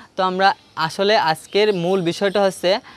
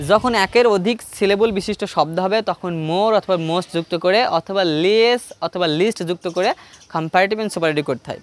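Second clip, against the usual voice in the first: ordinary talk, nothing marked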